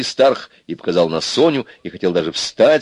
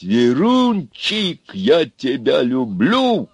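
high, very slow, cooing love words; tender declaration; 7 semitones higher, much slower than usual. A narrator's reading. Брюхатый prompts Аристарх's love confession, tender. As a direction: stretch each word sweetly.

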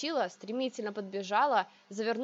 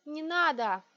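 higher, urgent begging; pleading; 5 semitones higher, slower than usual. A narrator's reading. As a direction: beg him to stop. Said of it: "И я взмолилась". (мольба)

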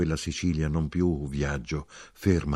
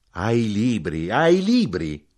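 drawn-out cry, building louder and higher; cried out; 4 semitones higher, 6 dB louder, slower than usual. A narrator's reading. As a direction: cry it slowly, then louder on the repeat. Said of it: "gridò".

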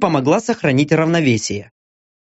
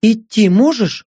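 lightly voiced woman, pitched higher; concerned woman; 5 semitones higher than usual. A narrator's reading. Мия checking Стриж can walk, mildly concerned. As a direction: ask with mild concern, slightly raised.

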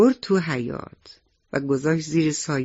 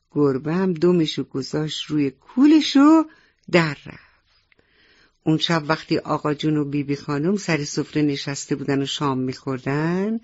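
ordinary delivery, nothing marked

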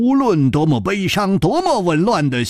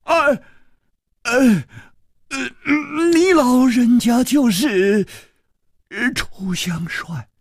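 slow, stammering awe; a servant realising he faces the famous hero, slow and stunned; dumbstruck; 8 semitones higher than usual. Acted. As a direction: gasp the name out, stunned. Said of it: "痴痴地说".

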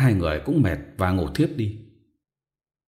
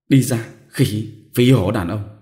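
slow, louder gritted-teeth shove-off; panting through teeth; 2 semitones higher, 5 dB louder, slower than usual. Acted. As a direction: spit it out between panting breaths. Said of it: "vừa thở dốc vừa nghiến răng". (thở dốc, nghiến răng đuổi chồng)

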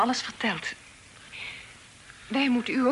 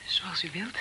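whisper; 3 semitones lower, 4 dB quieter, slower than usual. Acted. Daughter whispers to the guest.

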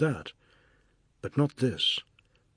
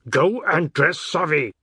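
higher, louder shouted command; shouted order; 4 semitones higher, 6 dB louder than usual. Acted. Husband shouting at his wife to dress Savi, loud shout. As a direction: bark the order when she doesn't move.

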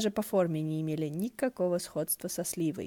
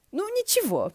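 bright high cheerful cry; cheerful exclamation; 13 semitones higher, 4 dB louder than usual. A narrator's reading. Ши Цинсюань cheerfully shrugs off the ruined hall, high bright exclamation. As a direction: brush it off with a cheerful shout. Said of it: "жизнерадостно воскликнул".

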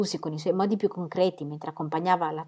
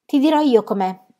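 higher, louder eager exclamation; raised-voice outburst; exclaiming; 6 semitones higher, 9 dB louder than usual. A narrator's reading. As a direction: call it out, keen to have your say. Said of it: "esclamò Fred".

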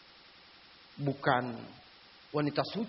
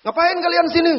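sharp, much higher, loud question; angry demand; 14 semitones higher, 10 dB louder than usual. Retold or imitated. Ustaz confronting the couple, loud and angry. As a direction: demand an answer.

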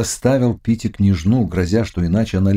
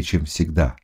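usual speaking voice

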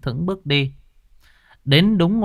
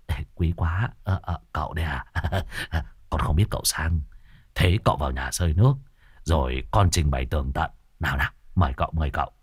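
put-on lower, softer fawning young man; young man Hùng; 7 semitones lower, 5 dB quieter, faster than usual. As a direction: ingratiate yourself with the visiting master. Young man greeting respectfully. (giọng thanh niên khúm núm, nhỏ nhẹ)

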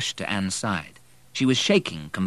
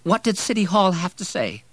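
high, loud, anxious question; distressed woman; 11 semitones higher, 5 dB louder than usual. A narrator's reading. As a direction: ask anxiously in a raised voice. Distraught Anne on the phone.

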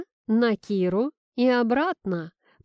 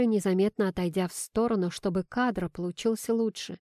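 usual speaking voice

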